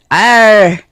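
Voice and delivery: short playful pirate arr; pirate growl. Pretend. Pirate day joke.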